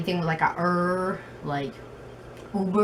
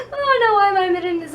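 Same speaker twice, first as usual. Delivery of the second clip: much higher, laughing and fast; cringe-laugh through it; embarrassed laugh; 14 semitones higher, faster than usual. Real, not acted. Embarrassed confession about unicorn.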